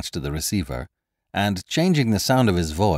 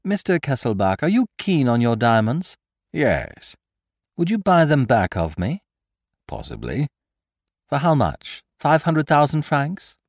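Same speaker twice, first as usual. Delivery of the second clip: lightly raised, mocking gentleman thief; Lupin's voice; 8 semitones higher, 3 dB louder than usual. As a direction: needle the prisoner with sly amusement. Lupin bargaining.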